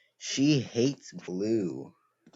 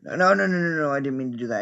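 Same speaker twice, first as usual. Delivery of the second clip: rapid, much higher and louder string of noes; panicked denial; 4 semitones higher, 3 dB louder, much faster than usual. Real, not acted. Player who just misclicked the puzzle, panicked fast protest. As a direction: blurt it out in real panic, fast.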